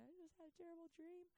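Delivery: quick, high, tearful voice; shaken wife. Retold or imitated. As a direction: blurt it out, still shaken. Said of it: "And she said".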